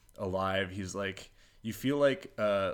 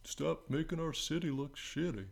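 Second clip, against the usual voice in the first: higher, softer whiny imitation of locals; mock Baltimore complainer; 6 semitones higher, 6 dB quieter than usual. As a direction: plead like an aggrieved Baltimore local. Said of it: "People were, like, mad".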